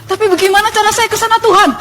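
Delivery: high, pleading mild yell; teriak-teriak. Retold or imitated. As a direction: call up to God in a high voice. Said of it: "Teriak-teriak di jalan gitu". (seruan bertanya, nada tinggi)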